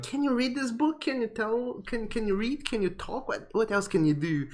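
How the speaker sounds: put-on high, pestering children's voices; eager kids. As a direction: badger him like curious little kids. Kids keep pestering the rat.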